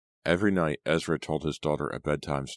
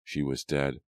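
ordinary delivery, nothing marked

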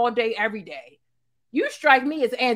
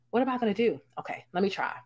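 ordinary talk

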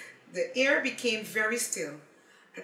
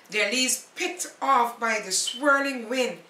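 plain reading voice

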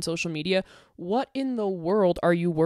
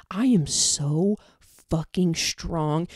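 slow, heavy, every word pressed; defiant slow declaration; much slower than usual. Real, not acted. To Jubilee, defiant: slow pressed declaration. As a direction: drag each word out in defiance.

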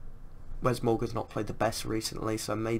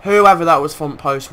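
loud, furious yell; angry shout; 3 semitones higher, 12 dB louder than usual. Real, not acted. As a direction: yell at the defender. Raging at defender.

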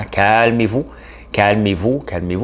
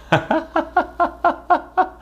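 high-pitched imitation laugh; Valérie Plante laugh. Host imitates the mayor's laugh for viewer's request. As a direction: mimic a mayor's giggle, pitched way up. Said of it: "le rire de Valérie Plante".

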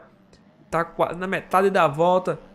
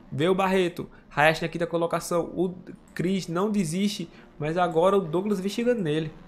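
usual speaking voice

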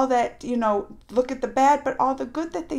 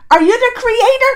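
loud, raised angry rebuke; Krishna scolding his doubting pupil, pitched up and loud; getting mad; 11 semitones higher, 15 dB louder than usual. Retold or imitated. Krishna angrily rebuking Arjun for questioning, loud and raised. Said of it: "Krishna starts getting mad".

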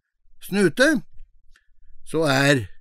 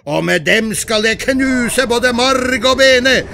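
big goat challenge; 8 semitones higher, 3 dB louder than usual. Acted. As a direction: shout the threat at the troll. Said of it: "Den var så grov i målet".

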